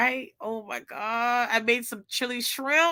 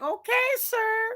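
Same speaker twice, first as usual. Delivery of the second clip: high, drawn-out "okay, sir"; impressed, high-pitched mock deference; playful compliance; 11 semitones higher than usual. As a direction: answer in a slow, high lilt. Retells reply to the cook.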